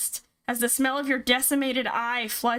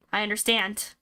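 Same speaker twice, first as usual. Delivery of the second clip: ordinary delivery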